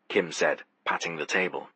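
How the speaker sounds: ordinary delivery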